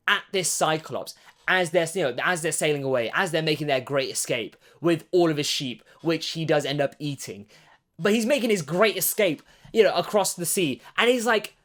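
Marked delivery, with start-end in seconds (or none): none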